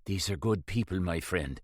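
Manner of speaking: gentle priest keeping it quiet. A narrator's reading. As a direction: reassure him in a low hush. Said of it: "Father Costello said quietly".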